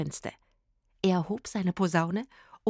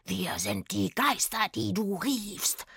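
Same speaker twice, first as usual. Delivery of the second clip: slow, drawn-out spook voice; eerie rattling ghost voice; rasping ghost; slower than usual. A narrator's reading. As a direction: rasp it slowly like a spirit. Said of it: "röchelte Itzig".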